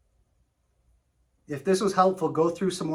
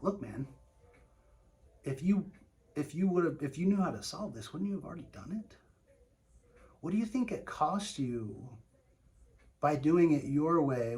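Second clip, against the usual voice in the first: soft, like talking to a friend; gently level with him, man to man; friendly; 3 dB quieter than usual. Shown as a demonstration. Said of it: "coming from almost ... like, friendship".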